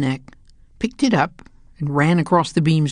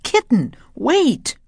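high pleading shout; shouted call; 8 semitones higher, slower than usual. A narrator's reading. Cats shout after the flying kitten, high. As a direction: call the kitten back, urgently. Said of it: "they shouted".

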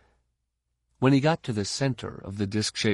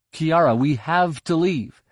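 calling out; 7 semitones higher, 5 dB louder than usual. A narrator's reading. Ben urges scared girl.

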